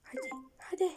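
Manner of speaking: whispering. Real, not acted. Urging herself on while whispering.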